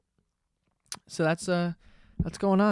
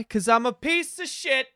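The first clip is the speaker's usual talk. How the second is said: self-mocking high; 9 semitones higher than usual. Real, not acted. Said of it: "you know why?".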